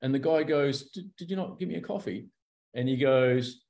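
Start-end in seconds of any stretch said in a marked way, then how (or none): 0.9-2.3 soft, higher-pitched hesitant question; lightly acted puzzled meekness; meek query. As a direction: act out a timid mate asking softly. Acting let-down mate asking meekly.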